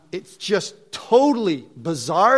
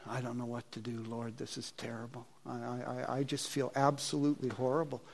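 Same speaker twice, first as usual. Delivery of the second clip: soft, low-pitched inner groan; hushed, downcast reenacted self-talk; low, dejected thought; 5 semitones lower, 14 dB quieter than usual. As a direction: murmur it to yourself, defeated. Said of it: "I'm low".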